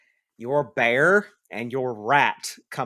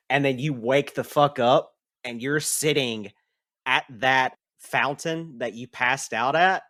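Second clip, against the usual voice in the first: ordinary talk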